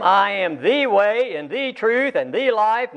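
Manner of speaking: each THE said "thee", slow and weighted; emphatic articles. Shown as a demonstration. Preacher quoting Jesus to the congregation, stressing each THE. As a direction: slowly lean on every THE, as "thee". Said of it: "puts emphasis upon what he is saying".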